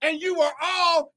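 fiery preaching. Acted. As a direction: preach it to the rafters. Preaching at full force.

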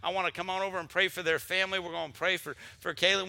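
normal talking voice

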